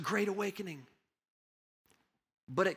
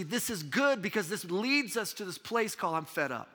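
high and loud preaching, driving on; full-force preaching; 7 semitones higher, 4 dB louder than usual. Acted. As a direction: preach it out to the whole church.

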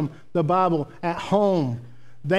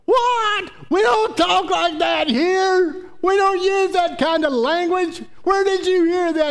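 mock outraged mama, loud and much higher; mama losing it; 13 semitones higher, 3 dB louder than usual. Mama scolding her little girl for cussing, high-pitched outrage. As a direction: imitate a mother shouting at her child, pitch way up. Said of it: "her mama about lost her mind".

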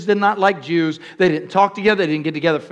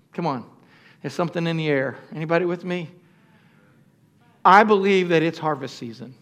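ordinary delivery

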